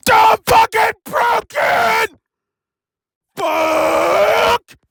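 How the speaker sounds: slow, high wailing shout; anguished shout. Acted. Broken engine wails about its fire. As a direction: drag out the despairing yell.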